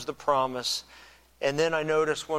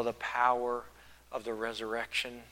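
normal talking voice

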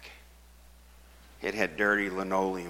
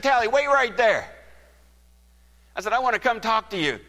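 calling out; 8 dB louder, faster than usual. Retold or imitated. Calling after him to stop.

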